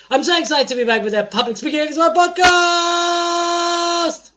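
high, loud show-opening cheer; over-the-top excited announcer yell; hyped-up excitement. Acted. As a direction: holler it, stretching the last word out long. Hyped-up show greeting to co-host.

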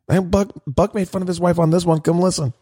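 high, playful calling-out voice; mock coaxing call. Podcaster calling a friend's wife to come listen, mock coaxing.

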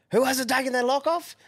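high put-on voice, mocking the caller; squeaky mocking impression. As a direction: pitch it way up like a whiny caller.

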